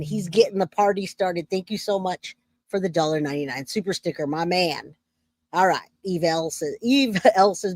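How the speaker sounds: usual speaking voice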